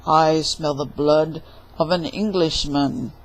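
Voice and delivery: low, loud giant boom. Acted. Giant announcing his presence to Jack, booming. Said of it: "heard a booming voice".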